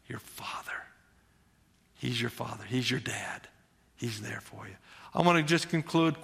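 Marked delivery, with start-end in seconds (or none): 0.1-4.8 tender hushed whisper. Real, not acted. Preacher whispers to listeners that God is their father. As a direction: breathe the words softly.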